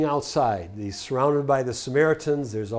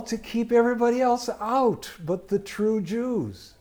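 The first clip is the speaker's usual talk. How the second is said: high, quickening wait-a-minute; surprised realization; 8 semitones higher, 4 dB louder than usual. Real, not acted. Preacher interrupting his own reading, surprised and high. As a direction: catch the congregation up short. Preacher stops, points out irony.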